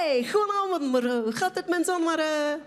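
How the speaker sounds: high, eager greeting in mangled Dutch; hammed-up keen parent stumbling into Dutch; motivated greeting. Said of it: "j'arrive motivé à l'école et je fais".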